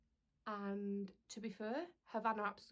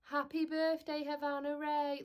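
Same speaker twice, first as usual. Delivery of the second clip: mock flat imitation; 6 semitones higher, 5 dB louder, slower than usual. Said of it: "no enthusiasm or excitement".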